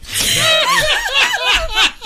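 high, loud burst of laughter; real high-pitched laughing fit; cracking up laughing. Real, not acted. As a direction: burst out laughing mid-act. Cracks up during the bit.

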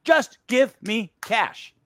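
Just give me cash drawn out slowly, each word leaned on; slow, emphatic repeat. Real, not acted. Fed-up gift receiver slowly repeating his demand for cash. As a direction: spell it out word by word. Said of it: "repeats demand".

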